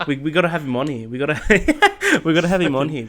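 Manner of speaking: loud, laughing and fast. Real, not acted. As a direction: push the words through laughter. Laughing with excitement.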